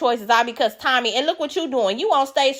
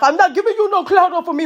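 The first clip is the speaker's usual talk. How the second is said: higher, mocking put-on voice; imitating Biggie; 7 semitones higher than usual. Host mimicking Biggie's no-clout speech, mocking. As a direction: parrot her speech with scorn. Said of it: "give us speeches about she not".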